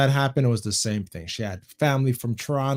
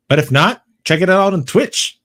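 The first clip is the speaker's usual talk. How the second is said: loud, higher-pitched shout-out; announcer-like plug; 7 semitones higher, 10 dB louder than usual. Real, not acted. Host sending viewers to his stream, loud plug. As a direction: belt out the plug to the audience.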